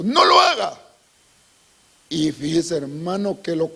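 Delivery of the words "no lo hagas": higher and loud forbidding cry; shouted warning. Acted. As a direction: forbid him outright. Priests confronting the king.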